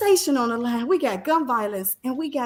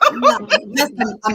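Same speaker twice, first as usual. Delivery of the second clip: laughing; 6 dB louder, slower than usual. Real, not acted. Teasing friends about tearing up.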